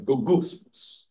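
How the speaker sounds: "logusmos" said slowly like a murmur; murmured word. Shown as a demonstration. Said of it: "you have to say it like that because it means murmur".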